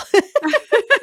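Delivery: loud high burst of laughter; laughing loudly at the joke. Real, not acted. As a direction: let out a big high laugh. Laughing at birth mom's joke.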